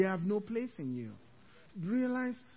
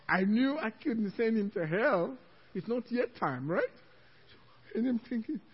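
normal talking voice

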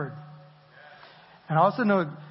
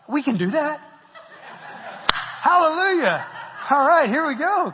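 much higher and louder, laughing through it; excited laughing glee; 10 semitones higher, 5 dB louder than usual. Retold or imitated. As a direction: burst out in delighted disbelief, then laugh. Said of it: "I'm like".

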